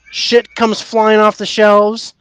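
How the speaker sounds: normal talking voice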